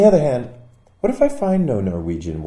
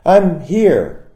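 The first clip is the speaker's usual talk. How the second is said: loud, high call, a bit less; a repeated, slightly fading shout; shouting again; 9 semitones higher, 7 dB louder than usual. Retold or imitated. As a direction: call out once more, less sure. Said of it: "I shouted again".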